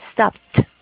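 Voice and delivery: loud "Stopped" ending in a T. Shown as a demonstration. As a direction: model "Stopped" as one syllable with a final T sound. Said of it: "reemplazar esa E D por el sonido de la T". ("Stopped" con sonido de T al final)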